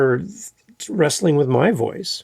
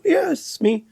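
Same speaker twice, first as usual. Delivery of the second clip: high, playful mock answer; mock reply; 14 semitones higher, slower than usual. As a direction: toss it off like a shy celebrity. Replying to the fan.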